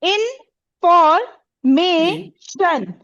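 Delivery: "इनफॉर्मेशन" slowly as इन... फॉर... मे... शन; four separate beats in "इनफॉर्मेशन"; syllable by syllable. Shown as a demonstration. Teacher gives pupils another example, "इनफॉर्मेशन" slowly split into four syllables. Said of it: "इसी तरह".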